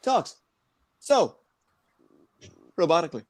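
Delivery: halting, robotic delivery with big pauses; each word alone, cut off by long pauses; William Shatner. Retold or imitated. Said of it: "the way William Shatner talks".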